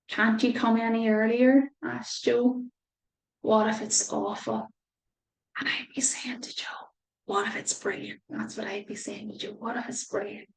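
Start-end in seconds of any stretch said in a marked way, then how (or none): 5.6-10.5 gentle hushed encouragement; soft whisper. Real, not acted. Reader whispering encouragement to worried Joe. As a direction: whisper the hopeful idea to Joe.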